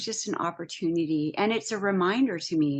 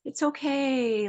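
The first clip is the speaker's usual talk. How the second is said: reassuring spirit; 5 semitones higher than usual. Retold or imitated. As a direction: soothe her like a frightened animal. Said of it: "I have spirit going".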